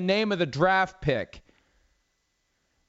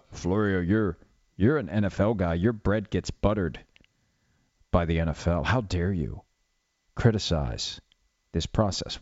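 lower, indignant critic voice; mock scolding critic; 8 semitones lower than usual. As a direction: play a pompous critic scolding Florio. Mocking an imagined scolding critic.

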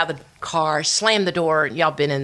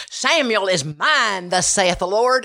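put-on slow, higher-pitched old-time radio preacher; J Vernon McGee; 5 semitones higher, slower than usual. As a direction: imitate a preacher's drawn-out pronouncement. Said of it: "J Vernon McGee, who's a preacher".

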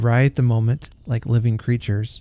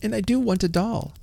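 put-on wanting little girl; 11 semitones higher, 4 dB quieter than usual. A narrator's reading. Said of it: "said the girl".

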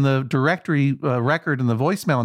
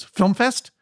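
higher, rising questioning tune; young woman caller; 7 semitones higher than usual. Retold or imitated. Young woman on the line checking the shop, questioning. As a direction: ask FILM FEST as a checking question. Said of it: "there was a young woman".

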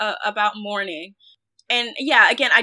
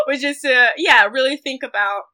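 laughing; 4 semitones higher, 7 dB louder than usual. Real, not acted. Laughs after amending statement.

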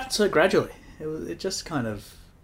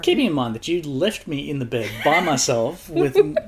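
loud mock-outraged complaint, higher and breaking into laughs; laughing indignation; 5 semitones higher, 9 dB louder than usual. Real, not acted. Partner scolding partner for leaving them with spirits, laughingly indignant. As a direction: protest loudly through laughter. Teasing complaint about being abandoned.

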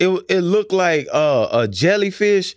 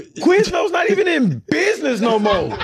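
high laughing outburst; laughing shout; 11 semitones higher than usual. Real, not acted. Laughing outburst at Quiznos claim.

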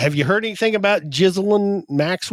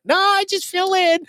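imitating nurse; 11 semitones higher than usual. Quoting the nurse's reply.

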